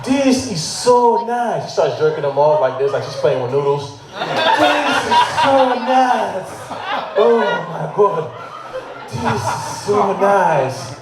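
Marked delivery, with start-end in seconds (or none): 0.1-1.6 high, very drawn-out delight; mock film actress. Film woman's exaggerated delight. 4.1-10.9 high, very slow exaggerated pleasure; mock film actress. Film woman's exaggerated delight.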